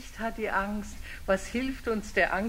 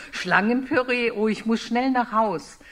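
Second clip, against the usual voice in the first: louder mock alarm; hammed-up snake rushing home; 6 dB louder than usual. Snake hears it will be pureed, loud mock alarm.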